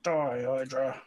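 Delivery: loud, drawn-out death threat; battle cry. Acted. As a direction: call it out at the enemy. Attacking the hydra in character.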